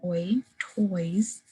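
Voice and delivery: "oi" sounded alone, then "toys"; oi diphthong shown. Shown as a demonstration. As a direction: isolate the "oi" glide before saying "toys". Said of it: "that oi diphthong sound".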